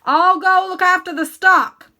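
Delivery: loud, high hurried shout; urgent call. Acted. Uncle Henry shouts to his wife while running, loud. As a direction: call it over the wind as you run.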